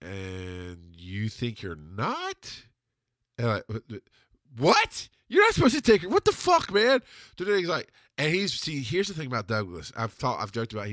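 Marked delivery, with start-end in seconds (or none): 0.0-2.6 very slow, softer, drawn out to a rising end; deadpan doubt. Retold or imitated. Best friend questions his angry buddy, slow deadpan doubt. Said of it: "he just kinda went". 4.6-7.0 high, loud indignant yell; acted outrage. Caller protests when best friend won't side with him, outraged.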